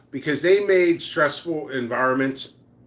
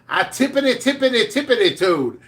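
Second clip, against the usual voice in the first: higher, playful sing-song on tippity; sing-song tiptoe; 8 semitones higher than usual. Retold or imitated. Sneaking up to the coworker's desk, playful high sing-song. Repeats tiptoe words for effect.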